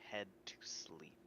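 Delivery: hushed, unvoiced storytelling; whispered narration. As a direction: whisper the scene setting. Game master narrates night scene.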